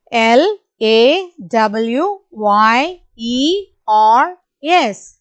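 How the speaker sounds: each letter slow and spaced; slow spelling. Shown as a demonstration. Teacher spelling slowly for the class. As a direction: spell slowly so kids can follow. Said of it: "repeat along with me".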